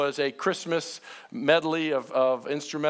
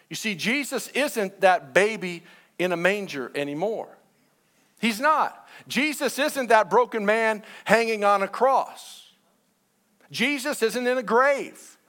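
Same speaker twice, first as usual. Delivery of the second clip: higher-pitched, rising sermon climax; emphatic preaching; 5 semitones higher, 4 dB louder than usual. Real, not acted. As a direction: proclaim it to the congregation with building force. Preaching climax about risen Christ.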